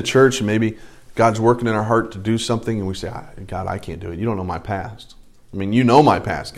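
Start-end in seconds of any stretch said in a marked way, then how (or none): 3.1-6.6 low, much softer, acted inner protest; reluctant excuse. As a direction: confess it to God under the voice. Voices a reluctant churchgoer to God.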